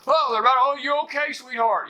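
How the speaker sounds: mock high, gushing worry; fussing parent. Mocking today's parents fussing over any noise, high gushing coo. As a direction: coo at the child way up high, overdone.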